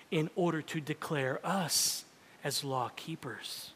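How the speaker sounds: ordinary talk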